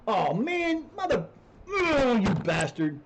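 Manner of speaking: high-pitched yelp sliding into a loud, slow curse; real gamer rage at losing his star player; frustrated outburst. Real, not acted. Losing his best player, he erupts in a frustrated outburst.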